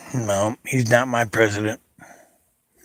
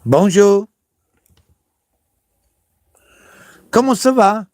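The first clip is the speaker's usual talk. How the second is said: mock French accent; 8 semitones higher, 3 dB louder than usual. Showing off foreign greetings.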